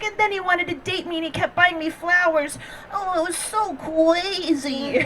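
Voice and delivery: high cutesy gushing, slowing at the end; high girly voice, last words drawn out; put-on girly voice. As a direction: imitate the friend's mock baby talk. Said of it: "she's like".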